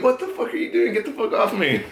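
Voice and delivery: very high-pitched laughing outburst; shocked protest. Retold or imitated. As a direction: act out fending someone off, laughing. Retells fending off the girl.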